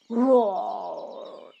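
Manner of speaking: loud roaring lion. Acted. Angry lion roars at the frightened mouse. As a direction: let out a big lion roar. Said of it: "The lion roared".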